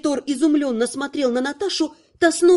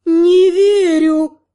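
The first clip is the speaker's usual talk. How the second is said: louder, very drawn-out outcry; slow, loud, appalled repeat; stunned disbelief; 3 semitones higher, 8 dB louder, much slower than usual. A narrator's reading. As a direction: stretch it out loudly in shock. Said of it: "просипела первой Амалика".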